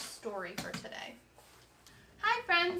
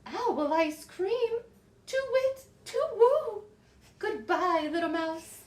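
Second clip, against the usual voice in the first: much higher, mock frightened hoot; panicked owl; 6 semitones higher, 4 dB louder, slower than usual. Owl fleeing from the gruffalo, high mock panic. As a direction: squeal to wit to woo in alarm.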